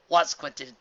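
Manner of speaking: usual speaking voice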